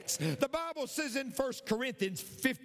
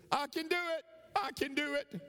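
high, called-out affirmation; acted shout; 7 semitones higher than usual. Dad's lesson to the boy, acted encouraging shout. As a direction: call it out high to the mountains. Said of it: "Shout out".

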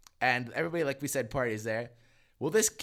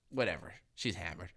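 ordinary delivery

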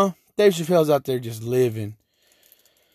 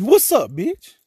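higher, louder mock challenge to someone staring; mock confrontational snap; 9 semitones higher, 4 dB louder, much slower than usual. Hyped on [name] in the morning, mock tough challenge. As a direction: pretend to square up, half joking. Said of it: "and you're like, look at me funny".

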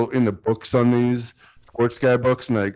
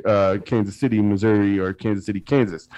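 ordinary talk, nothing marked

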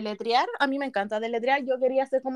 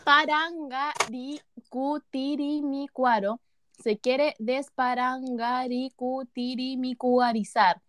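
very slow, each syllable spelled out; slow careful tongue twister; much slower than usual. Shown as a demonstration. Reading a tongue twister to friends, very slow and careful. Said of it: "A ver, dice".

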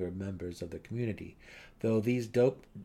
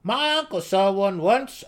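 high, loud, slow gnome brag; boastful gnome; 10 semitones higher, 10 dB louder than usual. A narrator's reading. Young gnome offers his uncle as proof, high and loud. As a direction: proudly put forward the uncle's claim.

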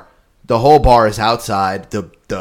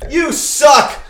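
high mocking heckle; heckling; 13 semitones higher than usual. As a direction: heckle the band. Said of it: "Sweet D goes".

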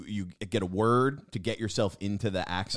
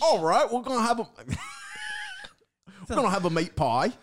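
Gordon Ramsay; 11 semitones higher than usual. Pretend. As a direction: shout it like a TV chef. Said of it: "trying to do a Gordon Ramsay impression".

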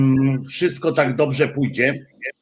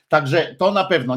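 usual speaking voice

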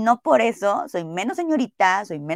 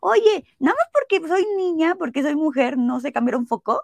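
indignant retort; 8 semitones higher than usual. Retold or imitated. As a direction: challenge the clerk, outraged. Ella reclama indignada. (reclamo indignado y agudo)

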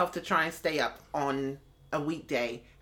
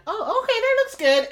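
high distracted voice; 4 dB louder than usual. Imitating distracted person.

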